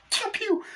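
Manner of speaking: very high-pitched mouth-made "pew" shots; imitated gunshots. Reviewer reenacts the shooting scene, squeaky gunfire noises. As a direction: squeal out toy-gun noises.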